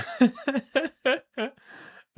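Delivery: high, loud burst of laughter; real cackling fit of laughter. Real, not acted. Bursts out laughing mid-sentence.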